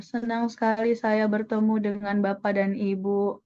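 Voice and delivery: monotone greeting with no rise or fall; deliberately toneless MC greeting; flat intonation. Shown as a demonstration. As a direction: say "Senang sekali saya bertemu" all on one level note. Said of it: "nggak mungkin kan datar terus misalnya".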